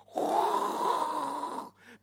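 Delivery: long, high rushing roar; imitated engine roar. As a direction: roar like a massive engine in the ears. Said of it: "like this massive engine was in my ears".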